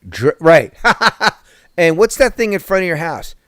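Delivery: usual speaking voice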